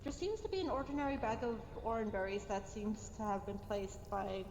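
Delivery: ordinary talk, nothing marked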